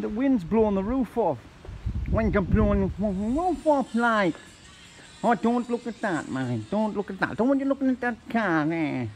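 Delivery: ordinary talk, nothing marked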